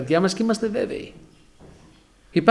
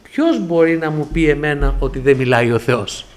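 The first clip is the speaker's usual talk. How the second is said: louder preacher's challenge to the congregation; preaching; 2 semitones lower, 5 dB louder than usual. Acted. Preacher to the congregation, rallying question. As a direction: ask it like a pulpit challenge.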